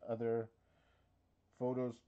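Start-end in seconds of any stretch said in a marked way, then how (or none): 1.6-2.0 hiccuping mid-word; a hiccup breaks the word. Real, not acted. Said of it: "I have the hiccups".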